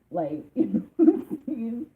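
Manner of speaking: amused laugh at her own impression. Real, not acted. Reader laughing to the client after imitating her, laughing.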